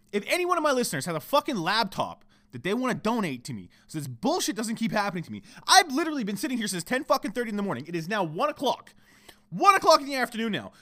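high-pitched, exasperated venting; frustrated rant. Real, not acted. Podcaster venting to listeners over repeated computer crashes, frustrated. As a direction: rant with real frustration, punching the swear words. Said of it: "You hear the fucking change in my tone?".

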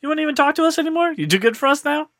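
high, mock-hurt teasing questions; mock offended. Host teasing cohost who'd join Marvel, mock offended. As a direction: pretend to be wounded by a friend's snub.

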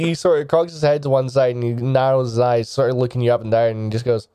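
normal talking voice

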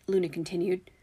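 usual speaking voice

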